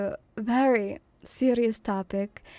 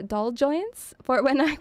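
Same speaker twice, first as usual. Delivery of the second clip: ordinary delivery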